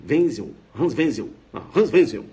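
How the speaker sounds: "Wenzel" punched out as VEN-zel; German pronunciation. Shown as a demonstration. Teacher drills the German name, W as V. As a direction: hit "Wenzel" with a V for the W. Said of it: "som de V".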